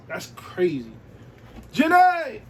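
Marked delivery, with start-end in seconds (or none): 1.7-2.4 full loud holler, way higher and louder. Pretend. Vlogger pretends to holler at J-Lo, loud call. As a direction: yell her name across the block. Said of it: "Just hollering".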